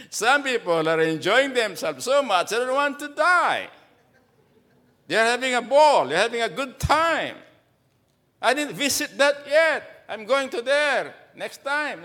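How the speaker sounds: high, lively preaching; fervent preaching. Acted. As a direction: play up the joke to the crowd. Preaching at full force.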